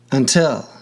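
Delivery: "until" stressed on TIL; light start, land on the TIL of "until"; stress on final syllable. Shown as a demonstration. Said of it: "on the final syllable".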